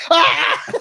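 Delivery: high startled cry of disgust; mock shocked yell. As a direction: yelp it high. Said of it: "He's going".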